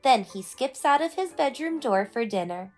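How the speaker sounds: plain reading voice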